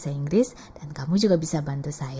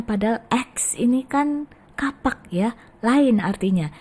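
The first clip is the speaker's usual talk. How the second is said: ordinary delivery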